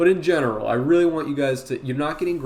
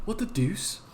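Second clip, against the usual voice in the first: higher-pitched put-on cartoon voice; cartoon character impression; 5 semitones higher, 4 dB quieter than usual. Streamer trying one more impression for chat, higher cartoon voice. Another impression after asking "anybody else.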